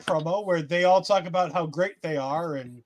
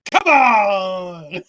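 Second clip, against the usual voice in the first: long drawn-out, loud "come on"; exasperated, drawn-out holler; exasperated shout; 6 dB louder than usual. Real, not acted. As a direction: cry it out in protest, stretching the word. Exasperated call after co-host's indifference.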